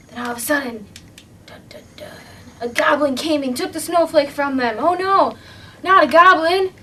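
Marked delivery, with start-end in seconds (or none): 1.4-2.1 whispered dun dun dun; spooky whisper. Acted. Suspense sound effect in story. 4.8-6.7 higher, louder frightened outcry; alarmed cry. Acted. Character sees goblin, cries out.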